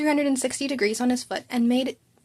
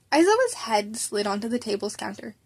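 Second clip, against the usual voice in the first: ordinary delivery, nothing marked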